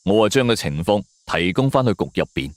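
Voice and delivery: usual speaking voice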